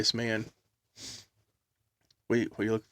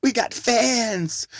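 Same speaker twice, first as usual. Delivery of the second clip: excited; 11 semitones higher, 3 dB louder, much slower than usual. Retold or imitated. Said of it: "He's like".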